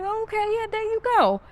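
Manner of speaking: high-pitched agreeable quote. Retold or imitated. Teller quoting themself humouring a customer, high-pitched and agreeable.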